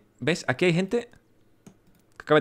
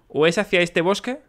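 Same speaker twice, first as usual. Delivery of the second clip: ordinary talk, nothing marked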